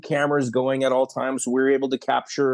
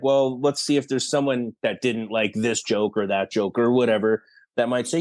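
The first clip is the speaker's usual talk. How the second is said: ordinary talk, nothing marked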